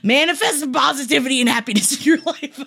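high, hammed-up cult leader exhortation; mock cult leader. Host preaches positivity to listeners, mock cult leader. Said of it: "I'm becoming a cult leader right now".

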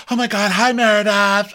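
high, gushing mock greeting; exaggerated high-pitched hello; Lisa greeting. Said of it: "So Lisa passes Meredith, and she's like".

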